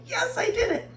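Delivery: high, bright victory squeal; triumphant cheer. Retold or imitated. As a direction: celebrate like you nailed it. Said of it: "you were like".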